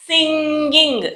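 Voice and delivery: సింగింగ్ slowly, lingering on the nasal "ng"; సింగింగ్ as a slow sound demo, "ng" held. Shown as a demonstration. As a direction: draw out సింగింగ్ as sing-ging. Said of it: "జ్ఞాగా పలుకుతాం అన్నమాట".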